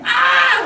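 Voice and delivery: sudden piercing high shriek, full out; imitating a screech. Cousin Heather screeching at the teasing boys, a piercing scream. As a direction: let rip a short shrill scream like an angry little girl. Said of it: "she would just start screeching, just yelling".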